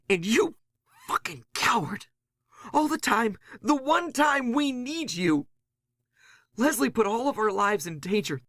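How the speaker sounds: high, strained furious tirade; shrill outrage at the coward who left; furious rant. Acted. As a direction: tear into him for abandoning them. Nix furious at the narrator.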